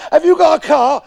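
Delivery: put-on loud, high drunken call; drunken shout. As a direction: bellow it like a drunk mate. Drunk men shouting in pub.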